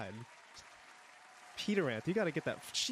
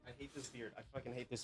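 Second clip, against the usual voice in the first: quiet grumble; 11 dB quieter, faster than usual. Real, not acted. Quietly complaining about beard.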